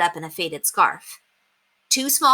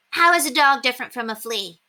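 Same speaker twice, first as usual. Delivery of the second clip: lightly voiced little girl; 6 semitones higher than usual. A narrator's reading. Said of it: "Ramona would ask".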